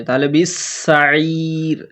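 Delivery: very slow, joined বিসাইর; slow recitation. Shown as a demonstration. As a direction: merge the সিন in বিসাইর slowly. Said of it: "শামসি বর্ণের কাজ হবে". (বিসাইর শামসি টানে)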